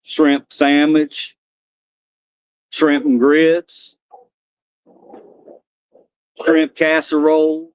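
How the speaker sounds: lightly voiced slow country character; Bubba. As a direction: list each dish slowly with a hint of country. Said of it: "he heard me doing the Bubba voice".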